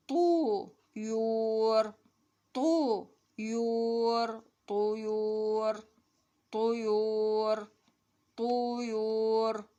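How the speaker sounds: very drawn-out syllables; slow model reading. Shown as a demonstration. Teacher reading the lesson to children, slow drawn-out reading. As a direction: stretch each long vowel for learners.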